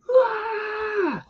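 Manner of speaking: high, sustained mock scream; long high-pitched "ah" scream; imitated scream. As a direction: imitate her shrieking from down the hall. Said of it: "you hear her scream".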